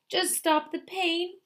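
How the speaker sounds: high, slow whimper; the fox whimpers a high, slow plea to the dentist. A narrator's reading. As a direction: plead weakly through the pain. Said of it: "whimpered the fro-, the fox".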